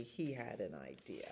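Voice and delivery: hushed storybook reveal. A narrator's reading. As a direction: drop to a hushed, secret aside. Narrator builds suspense.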